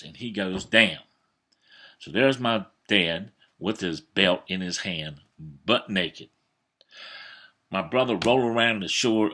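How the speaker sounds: normal talking voice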